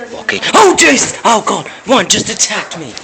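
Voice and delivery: high, loud startled yell; scared scream. Real, not acted. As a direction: shriek in real fright. Jump scare in game.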